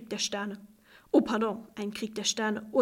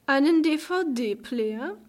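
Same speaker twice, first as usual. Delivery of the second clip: "Einen D-V-D-Player?" very slowly, higher, rising in scorn; mocking; 4 semitones higher, 3 dB louder, much slower than usual. A narrator's reading. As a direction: sneer "D-V-D-Player" back as if it were laughable. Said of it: "tönte eine höhnische Stimme".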